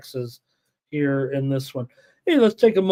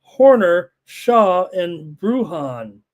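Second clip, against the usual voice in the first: higher-pitched roll call of names; lineup announcement; 4 semitones higher, 3 dB louder, slower than usual. Real, not acted. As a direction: read out the lineup name by name, winding down slowly on the last. Reads out team lineups.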